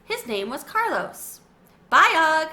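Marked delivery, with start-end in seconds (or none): 1.9-2.5 loud, high call across the room; shouted goodbye. A narrator's reading. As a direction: shout it to a friend leaving. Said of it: "I shouted to my friend".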